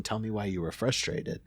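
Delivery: ordinary delivery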